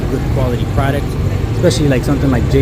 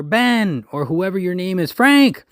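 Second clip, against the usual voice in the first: high-pitched calling out of names; mock yell; 7 semitones higher, slower than usual. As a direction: imitate calling out orders, peaking on the last name. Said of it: "instead of yelling like".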